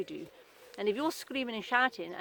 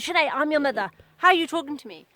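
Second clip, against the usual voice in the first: raised, higher and louder telling-off; scolding mother; 5 semitones higher, 9 dB louder, faster than usual. Retold or imitated. Mother scolding her teenage daughter in the kitchen, cross and loud. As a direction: snap at the daughter like a cross mum.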